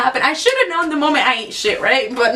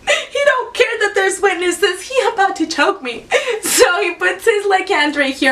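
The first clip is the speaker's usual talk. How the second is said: laughing through tears; 6 semitones higher than usual. Real, not acted. Dad about to choke her.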